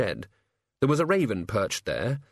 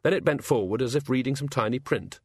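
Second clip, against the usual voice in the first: ordinary delivery, nothing marked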